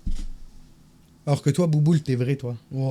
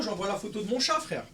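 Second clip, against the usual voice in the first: ordinary talk, nothing marked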